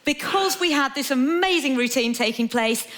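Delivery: ordinary talk, nothing marked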